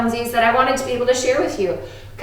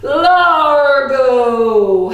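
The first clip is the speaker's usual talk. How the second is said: "Largo" drawn out slowly and louder; 4 dB louder than usual. Shown as a demonstration. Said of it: "say largo".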